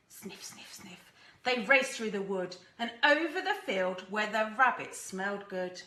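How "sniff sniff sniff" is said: breathy dog sniffs; whispered sniffing. Acted. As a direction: sniff softly under your breath.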